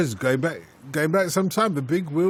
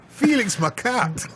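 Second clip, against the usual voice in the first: put-on slow, higher-pitched Scottish accent; mock Scottish accent; 5 semitones higher, slower than usual. Host reminding his pundit of the joke name, in a Scottish accent. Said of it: "do it in a Scottish accent".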